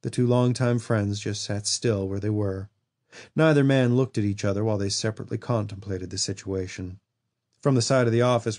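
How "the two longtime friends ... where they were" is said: usual speaking voice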